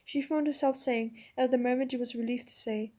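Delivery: plain reading voice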